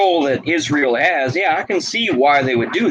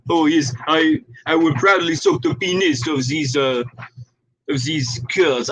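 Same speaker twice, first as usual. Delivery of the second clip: lightly hammed-up French accent; mocking fighters in a put-on French accent. Mocking lefty fighters, in a mild mock French accent. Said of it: "It just sounds good in French".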